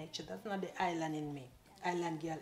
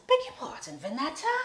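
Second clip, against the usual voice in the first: loud, affronted and higher; indignant; 8 semitones higher, 12 dB louder than usual. Retold or imitated. Challenging the stylist on the phone, loud and higher. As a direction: demand she repeat it.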